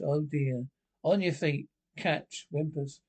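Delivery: ordinary talk, nothing marked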